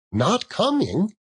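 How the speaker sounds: high, slow, let-down question; dismayed surprise. Acted. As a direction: let his cheer drop at the news. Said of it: "with a sudden declension in his high spirits".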